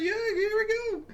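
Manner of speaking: soft, high squeal of mock eagerness; eager composer.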